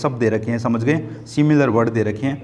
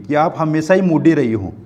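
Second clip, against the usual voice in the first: usual speaking voice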